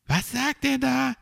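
very high, puzzled little creature; high-pitched baffled question; Borstel. A narrator's reading. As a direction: pipe up confused in a tiny high voice. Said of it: "zischte Borstel Mauz zu".

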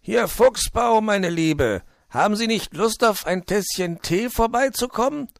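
high, loud phone invitation; animated storybook fox on the phone; cheery fox character. Acted. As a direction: coax the magpie with bubbly enthusiasm.

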